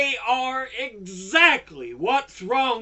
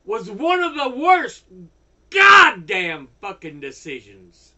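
loud, higher, slowed-down rage; angry outburst; 3 semitones higher, 6 dB louder than usual. Real, not acted. Furious ranter blasting the court decision. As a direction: pound out each word in fury. Ranting against lobby group.

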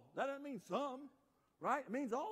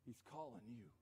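hushed, low invitation; gentle summons; 8 semitones lower, 12 dB quieter than usual. Acted. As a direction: deliver the news very softly. Softly voicing the summons to Bartimaeus.

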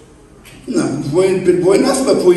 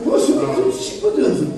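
ordinary talk

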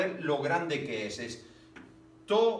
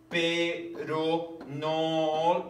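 slow syllable reading; much slower than usual. Shown as a demonstration. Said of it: "Una, dos".

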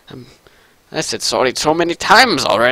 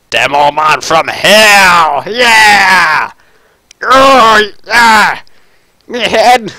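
loud, high, drawn-out Demoman bellow; Demoman war cry; 7 semitones higher, 5 dB louder, much slower than usual. Acted. Demoman announces himself from hell, roaring. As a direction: bellow like Demoman, stretching the vowels. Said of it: "turning into a s-, true demo man".

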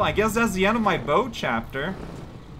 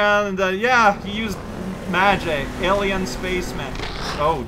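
normal talking voice

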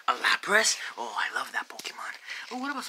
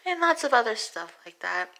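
higher, gentle voice, sinking toward the sign-off; Princess Peach, newsreader; 4 semitones higher than usual. Acted. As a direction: read sad breaking news as a dainty princess. Plays Princess Peach reading news.

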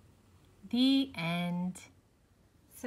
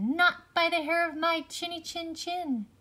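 high, louder brave pig; defiant pig; 9 semitones higher, 6 dB louder than usual. A narrator's reading. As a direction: pig refusing boldly. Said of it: "the third little pig said".

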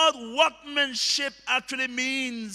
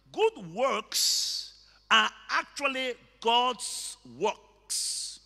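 usual speaking voice

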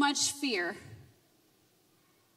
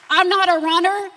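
loud, firm, and pitched up; emphatic declaration; 4 semitones higher, 8 dB louder than usual. Real, not acted. Preaching to the church, she declares it loudly. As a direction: insist on it with force. Testimony builds in force.

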